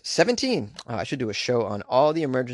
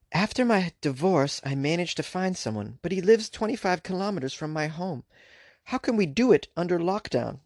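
higher-pitched voice for a woman's quote; lightly put-on female voice, pitched up; woman from Dijon; 6 semitones higher than usual. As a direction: read her quote in a lifted, lilting voice. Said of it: "I cannot do a female French accent".

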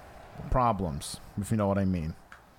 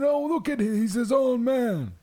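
put-on grumpy old man; 12 semitones higher than usual. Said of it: "He said".

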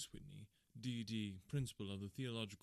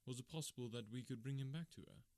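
ordinary delivery, nothing marked

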